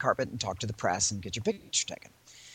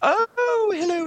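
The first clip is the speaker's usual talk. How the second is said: high, swooping regal greeting; hammed-up royal hello, very high; mock Queen of England; 3 dB louder, much slower than usual. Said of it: "She doesn't sound like the Queen of England".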